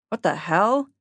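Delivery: sudden loud startled yelp. Acted. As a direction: yelp it out in shock. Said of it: "he yelped".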